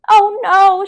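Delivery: high, loud cry of alarm; a child's frightened shriek; scream. A narrator's reading. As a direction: gasp out in horror. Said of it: "Sheila screamed".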